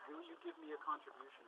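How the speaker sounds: usual speaking voice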